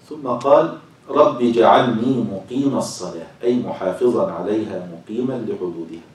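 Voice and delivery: slow, loud, drawn-out scriptural reading; formal reading aloud. Acted. Teacher reads verse and commentary to class, slow formal reading. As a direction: read it out with stretched, emphatic phrases.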